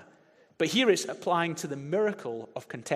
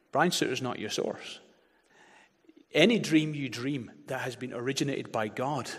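ordinary talk

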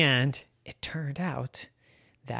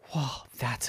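whispering; 9 dB quieter than usual. Acted. Gilbert amazed by glasses idea.

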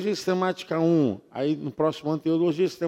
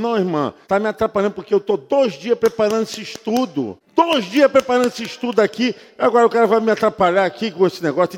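retold complaint; 4 semitones higher, 5 dB louder, faster than usual. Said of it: "Eu falei".

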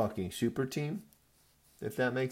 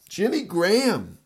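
exasperated shout; 9 semitones higher, 8 dB louder than usual. Real, not acted. Cursing player who cost him.

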